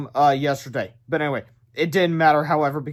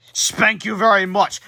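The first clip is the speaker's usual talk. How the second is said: slow, louder hero quip; hammy hero quip; 4 semitones higher, 6 dB louder, slower than usual. Acted. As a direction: deliver it like a smug superhero. Hero's comic catchphrase.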